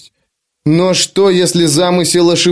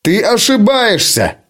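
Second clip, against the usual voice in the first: ты ошибаешься roared much higher; roaring; 7 semitones higher than usual. Acted. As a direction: bellow ты ошибаешься in fury. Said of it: "реву я".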